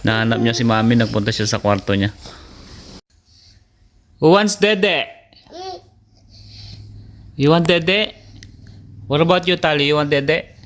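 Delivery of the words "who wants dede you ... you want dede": high-pitched, singling out little ones. Real, not acted. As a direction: offer it to toddlers in a high voice. Parent offering toddlers milk.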